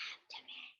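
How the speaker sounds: abdomen whispered, very soft, no voice. Shown as a demonstration. Teacher whispering abdomen for the class to copy. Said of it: "Say it in a whisper".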